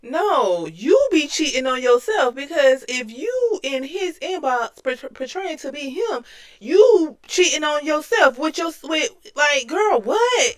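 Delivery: loud, high, scolding rebuttal; indignant outburst. Acted. Co-host correcting the absent rival, indignant outburst. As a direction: argue back at full volume, pitch up.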